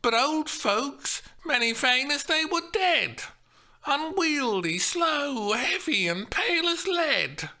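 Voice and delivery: high, youthful Juliet voice; girlish Shakespeare heroine, reading; Juliet read aloud. Acted. Steph reading Juliet's lines at rehearsal, high and eager.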